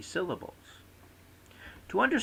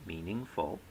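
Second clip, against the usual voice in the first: "meaningful" lower, main stress on MEAN, lighter on FUL; primary and secondary stress; 4 semitones lower, 7 dB quieter than usual. Shown as a demonstration. Lecturer showing three syllables, MEAN strongest and FUL secondary. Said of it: "primary stress on the first syllable".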